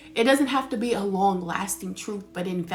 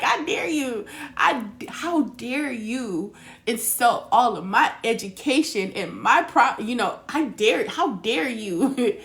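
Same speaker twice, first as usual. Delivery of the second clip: mock furious; 4 semitones higher, 6 dB louder than usual. Scolding her dad for rejecting her advice, mock furious rant. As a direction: hurl the outrage at her dad, half laughing. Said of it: "I was so mad. I was like".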